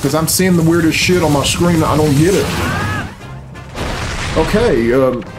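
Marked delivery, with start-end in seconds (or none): none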